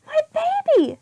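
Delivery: a shocked mother crying out; alarmed mother. Acted. Missus Lane seeing her messy baby, alarmed.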